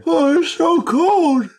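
high, slow shivering whine; freezing whine. Acted. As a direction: moan about the cold, up high. Freezing character just woken.

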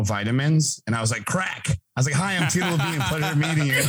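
usual speaking voice

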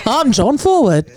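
lightly hammed English accent; a touch of bad English accent; mock English accent. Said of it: "That's a good English accent".

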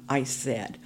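usual speaking voice

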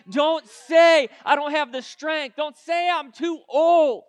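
loud, punched preacher's rebuke, slowing on each "say"; full-force preaching. Acted. God tells the youth what not to say, forceful preaching. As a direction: hammer each command home to the congregation.

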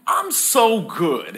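a hint of a proud, preening voice; smug God voice. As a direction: savour how good you are. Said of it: "He's saying".

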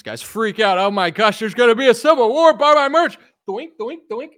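lightly hammed-up YouTuber alarm; mock hype streamer. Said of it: "let me do a Tim Pool".

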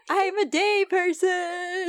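high, drawn-out put-on voice; mock impersonation. Player teases glowing friend in the shadows, high drawn-out mock voice. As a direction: stretch it out high, teasing. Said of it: "You're like".

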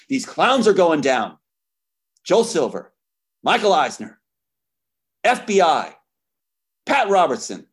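loud, high, furious naming of names; he is done playing games and lets it out; real anger. Real, not acted. To his accusers, a loud furious outburst. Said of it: "we've got you and I'm pissed".

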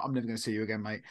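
low, soft inner voice; brain's aside. Retold or imitated. Brain dismissing a stranger met once, inner aside. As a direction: mutter it as a private thought. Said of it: "my brain's like".

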